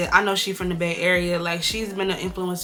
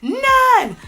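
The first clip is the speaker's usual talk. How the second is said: "None." shrieked much higher and louder; over-the-top emphatic; 7 dB louder than usual. Real, not acted. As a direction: push "None." way up into a squeal. Hyping concealer, repeats word.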